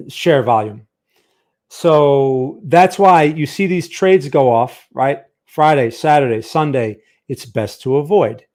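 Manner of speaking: ordinary delivery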